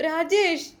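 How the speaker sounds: high-pitched distressed call. A narrator's reading. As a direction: call out to a husband in alarm. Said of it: "അവൾ ഉറക്കെ വിളിച്ചു".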